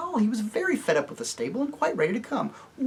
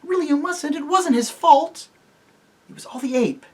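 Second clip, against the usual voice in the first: much higher desperate plea; frantic pleading; 12 semitones higher, 3 dB louder than usual. Acted. Jill begs Tirian to spare the donkey, high and frantic. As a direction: beg him to spare it.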